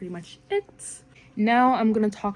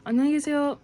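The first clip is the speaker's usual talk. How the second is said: fast; 8 semitones higher, much faster than usual. Shown as a demonstration. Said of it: "is, like, fast".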